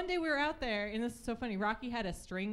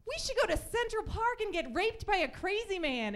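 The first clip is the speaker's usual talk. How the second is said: much higher, cheerful tone; cheerful mom; 9 semitones higher than usual. Retold or imitated. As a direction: say it like it's a fun idea. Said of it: "my mom said".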